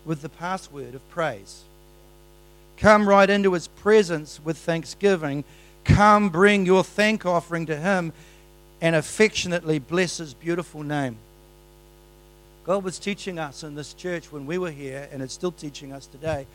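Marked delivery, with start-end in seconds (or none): 2.8-11.2 loud, high proclaiming; loud fervent preaching; preaching at full force. Acted. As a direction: call the congregation in. Preaching scripture loudly.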